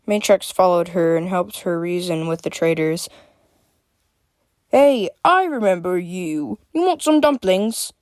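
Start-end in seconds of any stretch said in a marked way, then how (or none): 4.7-7.9 chuckling familiar vendor. A narrator's reading. Dumpling seller recognizing Freya, chuckling character voice. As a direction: greet a known customer jovially. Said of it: "a familiar voice chuckled".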